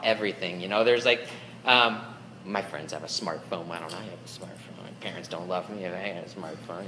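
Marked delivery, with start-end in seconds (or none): 2.4-6.8 low, much softer sulky whine; whiny kid. Pretend. Preacher voicing a kid whining for a smartphone, soft and sulky. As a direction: whine it under your breath like a kid.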